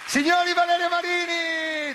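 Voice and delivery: loud showman announcement; announcer shout. Acted. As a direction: belt out the guest's name. Announcing guest on stage.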